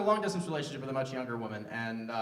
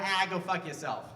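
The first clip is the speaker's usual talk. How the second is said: slow Boston brush-off; same curse, drawn out in a Boston accent. Shown as a demonstration. Comic testing Southerners on Yankee accents, Boston accent. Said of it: "Boston, you guys are great".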